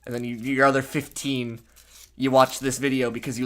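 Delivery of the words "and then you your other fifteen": ordinary talk, nothing marked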